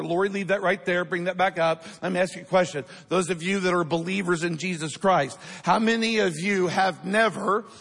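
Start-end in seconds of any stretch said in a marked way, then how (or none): 5.6-7.7 "never" stretched out and hit hard; stress on never. Real, not acted. Preacher asking believers about sin, "never" heavily emphasized. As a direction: lean slowly and firmly on the word "never". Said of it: "I emphasize the word never".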